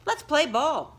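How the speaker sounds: put-on high, loud call to the kids; eager call. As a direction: call them to play. TJ calls to start game.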